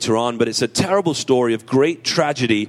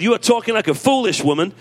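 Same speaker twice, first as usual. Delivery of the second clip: a hearty, relishing rebuke, pitched higher; with gusto; 9 semitones higher than usual. Acted. Pastor relishing Job's rebuke to his wife, with gusto. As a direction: savour the rebuke with big gusto. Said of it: "I say that with a lot of gusto".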